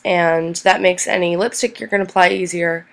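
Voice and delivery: normal talking voice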